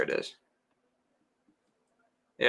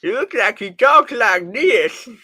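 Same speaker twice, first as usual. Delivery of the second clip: higher, louder cotton-mouthed mumbler; Marlon Brando voice; 9 semitones higher, 9 dB louder than usual. Pretend. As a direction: talk like there's cotton in your mouth. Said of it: "he could have some Marlon Brando stuff going on".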